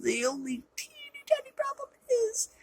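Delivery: put-on sarcastic squeak for teeny tiny; mock tiny voice. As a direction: shrink the voice way up to play it down. Sarcastic understatement of plot hole.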